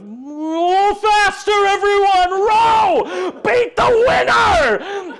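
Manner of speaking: high, drawn-out rallying shout; yelling at his team. Acted. Galapagos urging his rowers on, yelling. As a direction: yell them on at full force. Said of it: "Galapagos yelling at his team".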